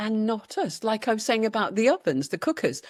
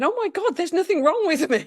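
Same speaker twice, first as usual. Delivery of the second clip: high, bright burst of relief; overjoyed realisation; 9 semitones higher, 4 dB louder than usual. Retold or imitated. Reading in the shop, she cries out in high joyful relief. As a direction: let the discovery lift the voice way up. Retelling tearful reading revelation.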